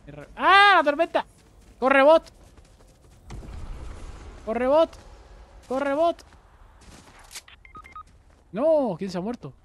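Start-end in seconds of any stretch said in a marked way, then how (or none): 0.4-1.3 high, loud storm alarm; alarmed yell. Real, not acted. Player spots the storm closing in, alarmed high yell. 1.8-6.2 high, loud command to run; urgent shouting. Real, not acted. Player urges the bot to flee, urgent high shout. 8.5-9.5 raised, louder cry of dismay. Real, not acted. Player learns someone has died, dismayed outcry.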